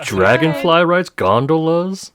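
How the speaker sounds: high, loud and drawn out; excited. Real, not acted. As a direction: announce it with glee. GM excitedly lists attractions.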